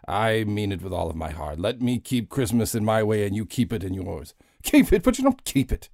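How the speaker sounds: put-on bitter old miser losing his temper; Scrooge. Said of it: "one-man Christmas Carol show".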